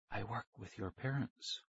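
very soft, whispery voice. A narrator's reading. Stranger halting the departing kids, softly. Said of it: "he said softly".

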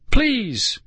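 very high drawn cry; screamed plea. A narrator's reading. Girl begs the sled to stop, screaming. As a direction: plead at the top of the pitch range. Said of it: "Nicole screamed".

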